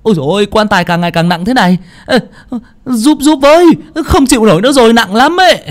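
high, straining calls for help; loud, frantic high-pitched wail for help; yelping cries. Acted. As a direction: yelp in panic under a heavy load. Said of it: "kêu lên oai oái".

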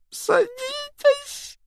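high, drawn-out sobbing wail. A narrator's reading. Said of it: "голосил он".

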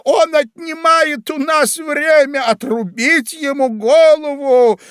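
high, loud, slow furious royal shout; queen shouting. A narrator's reading. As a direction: bellow the execution order like a furious queen. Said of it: "королева крикнула".

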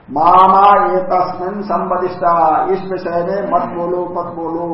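loud, drawn-out protest, easing off; pained protest. Retold or imitated. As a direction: open high and loud on the repeated words, then settle. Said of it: "अत्यंत पीड़ा होती है ना".

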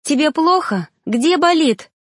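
high, anxious questions; fussing anxiously. Acted. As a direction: fuss over him, worried. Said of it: "суетилась она возле меня".

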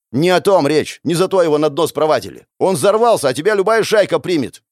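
high, loud rebuttal; heated protest. A narrator's reading. Hero denies wishing the elder dead, protesting loudly. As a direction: argue back forcefully. Quarrel, refuting the elder's accusation.

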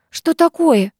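high, startled question; flustered, pitch shooting up; alarmed. A narrator's reading. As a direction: jump in with sudden alarm. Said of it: "всполошилась Третьякова".

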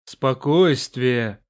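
slow, held-back dragon voice; restrained dragon rumble. A narrator's reading. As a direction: rumble it, holding the voice in. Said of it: "стараясь сдерживать голос, пророкотал дракон".

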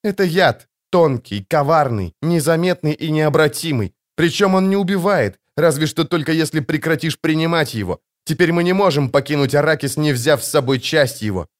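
ordinary delivery

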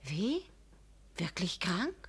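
breathless whispered dismay; hushed, frightened question; shocked whisper. Acted. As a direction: whisper it in sudden fear.

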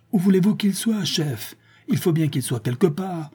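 high, strained exasperation without shouting; furious, under restraint. A narrator's reading. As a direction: retort in a rage, pitch pushed up.

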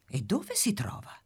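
hushed, secretive whisper; whispered question. Acted. Cat asks eagerly.

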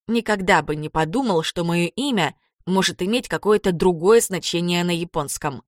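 ordinary delivery, nothing marked

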